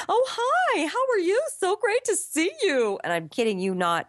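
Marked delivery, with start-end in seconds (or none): 0.1-3.0 much higher, gushing sing-song greeting; gushing fake-sweet actress. Actress greeting an old friend at an audition, high gushing voice. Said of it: "they spoke just like that with that tone of voice".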